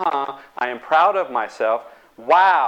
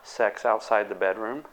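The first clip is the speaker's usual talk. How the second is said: usual speaking voice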